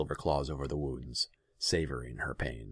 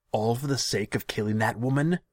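high, loud incredulous question; outraged questioning; appalled disbelief; 8 semitones higher, 8 dB louder than usual. A narrator's reading. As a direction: demand an answer, voice climbing. Ruler is appalled.

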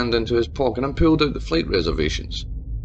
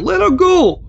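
much higher and louder plea; pleading shout; 8 dB louder, slower than usual. A narrator's reading. Mayor shouts at Tabitha to free his daughter. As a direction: shout to save her. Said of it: "said Jim".

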